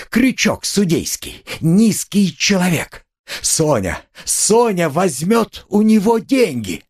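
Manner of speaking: high, drawn-out insults; shrieking indignation. A narrator's reading. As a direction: hurl insults in a high, frantic voice. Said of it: "взвизгнула Катерина Ивановна". (визгливая гневная брань)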